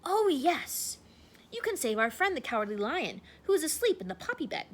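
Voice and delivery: quick eager scarecrow voice; said quickly. Acted. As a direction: blurt out the idea quickly. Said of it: "said quickly".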